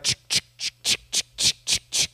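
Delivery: voiceless, rhythmic "chick" noises; imitated card clicking. Showing everyone the little cards, imitated clicking sound. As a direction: make a run of short unvoiced clicks like a toy card. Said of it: "those little cards where you were like".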